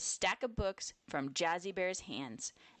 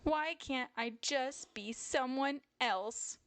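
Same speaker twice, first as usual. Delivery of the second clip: higher-pitched, plaintive little bear; understated tearful complaint, pitched up; mild crying lament; 8 semitones higher than usual. A narrator's reading. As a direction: lament it in a high child-bear voice. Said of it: "Jazzy Bear would cry and cry".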